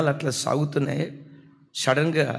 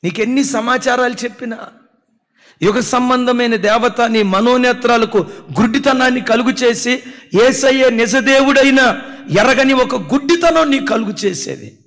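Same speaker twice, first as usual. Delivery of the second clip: very loud, high fiery sermon; impassioned pulpit shouting; shouted preaching; 9 semitones higher, 10 dB louder than usual. Acted. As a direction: thunder it at the believers. Preaching at full force.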